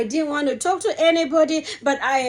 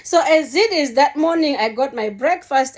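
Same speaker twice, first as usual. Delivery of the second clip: normal talking voice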